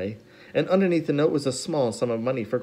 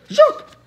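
very high loud squeal; baby shriek; 10 dB louder than usual. A narrator's reading. Sunny banging her spoon, high baby shriek. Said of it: "Sonny shrieked".

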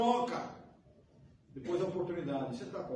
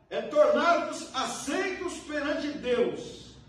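loud, slow, high preaching climax; preaching at full force; 8 semitones higher, 7 dB louder, slower than usual. Acted. Preacher to congregation, proclaiming slowly and loudly. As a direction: build each phrase up and proclaim it to the congregation.

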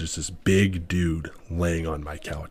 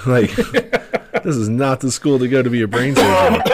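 higher, louder, laughing through it; laughing disbelief; 7 semitones higher, 8 dB louder than usual. Real, not acted. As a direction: laugh while you say it.

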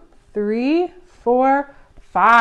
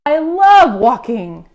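loud, slow, drawn-out delight; delighted gushing; 3 semitones higher, 7 dB louder, slower than usual. Acted. Beaver loves Bird's idea, delighted loud gushing. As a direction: gush with stretched-out enthusiasm.

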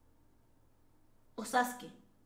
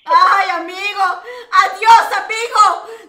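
loud, raised-pitch cry of frustration; exasperated shout; 4 semitones higher, 15 dB louder than usual. Real, not acted. Host dismissing a player who came up with nothing, exasperated shout. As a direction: yell them away, fed up.

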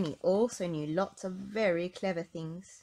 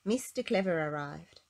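ordinary delivery, nothing marked

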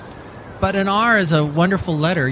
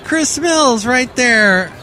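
excited high voice; 12 semitones higher, slower than usual. Acted. Excited showing the picture.

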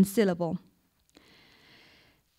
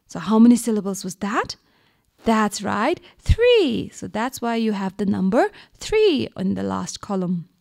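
usual speaking voice

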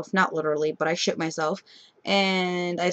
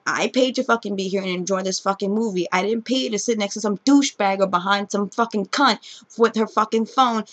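usual speaking voice

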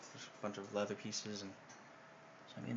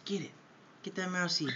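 urging the pet; 7 semitones higher than usual. Real, not acted. Owner urging bird.

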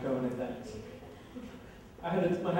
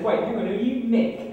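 higher, louder startled question; alarmed double-take; sudden realisation; 7 semitones higher, 7 dB louder than usual. Retold or imitated. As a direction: it dawns on you mid-date. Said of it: "It was at this moment I said".

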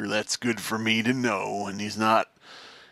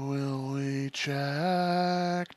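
slow drawl; 8 dB quieter, much slower than usual. Pretend. Drawn-out, slow question.

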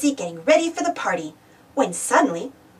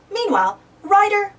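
ordinary delivery

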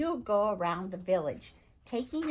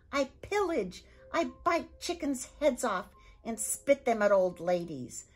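loud, much higher disgusted Viking roar; 8 semitones higher, 8 dB louder than usual. Acted. Viking father mocking his son's polite words, disgusted roar. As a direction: spit the words back in scorn. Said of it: "screwed up his face in disgust".

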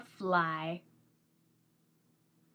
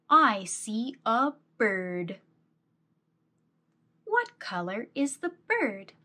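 put-on reading to a toddler. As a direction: read it like to a small child. Reads picture book to child.

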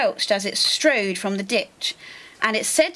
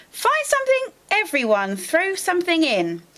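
cheerful call; 7 semitones higher than usual. Acted. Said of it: "said with a grin".

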